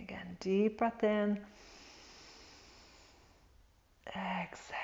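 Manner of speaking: whispering. Acted. Breath cue whispered during practice.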